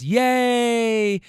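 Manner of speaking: high, drawn-out yay; mock cheer. Passengers greet the returning man, drawn-out mock cheer. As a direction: cheer his arrival with a long high note. Acted cheer when he arrives.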